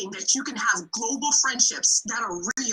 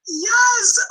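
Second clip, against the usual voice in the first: excited yes; 10 semitones higher, 6 dB louder than usual. Real, not acted. Ecstatic guest greets everyone.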